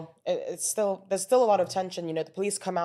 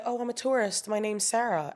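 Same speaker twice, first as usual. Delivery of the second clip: put-on American accent; 3 semitones higher than usual. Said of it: "my American accent was a lot stronger".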